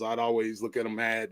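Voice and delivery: ordinary talk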